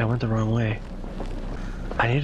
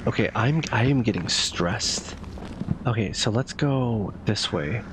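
usual speaking voice

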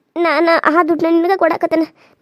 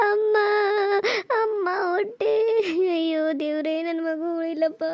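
pained moans fading into weak, softer sobbing; crying in pain; 3 semitones higher, 3 dB quieter, slower than usual.

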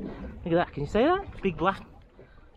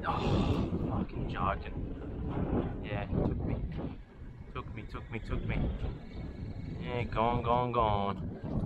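quiet disbelief; 7 semitones lower, 9 dB quieter than usual. Real, not acted. Fish lost, muttered disbelief.

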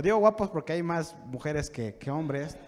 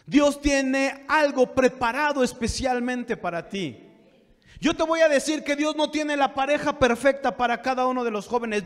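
raised pulpit proclamation; emphatic preaching; 8 semitones higher, 5 dB louder than usual. Real, not acted. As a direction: proclaim it to the congregation in a higher voice. (prédica enfática con voz elevada)

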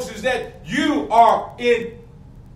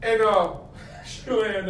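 ordinary delivery

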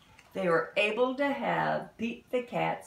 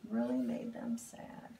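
whispered echo; 10 semitones lower, 6 dB quieter than usual. Real, not acted.

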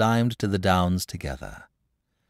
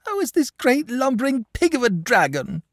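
acted tearful child, very high; high-pitched, upset outburst; sobbing; 4 dB louder than usual. A narrator's reading. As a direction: wail the grievance in a little boy's voice. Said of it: "sobbed the boy".

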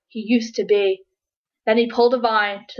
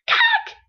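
sharp squeaky "Cack" of shock; shocked gasp. A narrator's reading. Verdi finding green skin, squeaky gasp. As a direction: choke out a high startled cry. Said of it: "he gasped".

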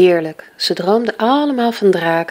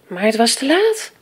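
ordinary delivery

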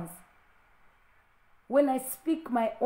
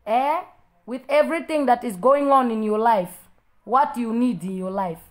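loud preaching; 9 dB louder than usual. Real, not acted. Preacher confronting church member.

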